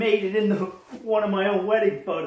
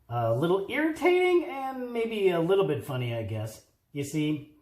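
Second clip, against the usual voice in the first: usual speaking voice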